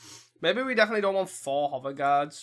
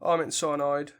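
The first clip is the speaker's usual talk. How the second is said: ordinary talk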